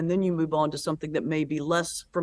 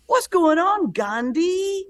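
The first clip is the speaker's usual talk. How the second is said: high jeering heckler, a bit louder; mocking taunt; 12 semitones higher, 4 dB louder, slower than usual. Second guy leaning over to taunt him, high mocking jeer. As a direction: call it out as a cheap shot, much higher. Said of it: "the other guy leans around his friend and goes".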